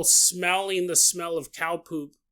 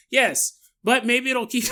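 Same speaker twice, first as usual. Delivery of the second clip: ordinary delivery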